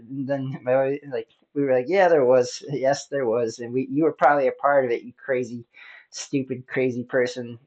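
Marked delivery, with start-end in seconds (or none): none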